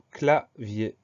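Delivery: "clavier" split slowly into cla-vier; slow dictation, separating cla and vier in "clavier"; syllable by syllable. Shown as a demonstration. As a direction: pause between the two syllables of "clavier". New word dictated syllable by syllable.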